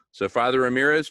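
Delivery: ordinary talk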